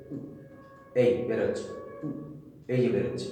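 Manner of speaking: উ blown out through narrowed, rounded lips; ঠোঁট সরু করে উ; rounded-lip demo. Shown as a demonstration. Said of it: "চাপা হয়ে সরু হয়ে". (চাপা সরু ঠোঁটে উ)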